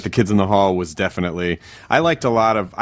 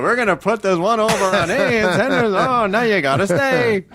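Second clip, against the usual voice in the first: a much higher, slow, put-on sketch voice; sketch character; 12 semitones higher, slower than usual. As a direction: play a scheming comedy-sketch character, slowly. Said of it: "It sounds like a Kids in the Hall sketch".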